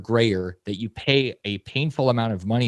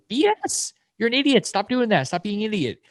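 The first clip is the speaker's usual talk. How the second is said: much higher, forceful "B S"; exasperated rant; 11 semitones higher than usual. Real, not acted. Telling listeners to stop being idiots, exasperated. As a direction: call them out sharply. Berating lazy people.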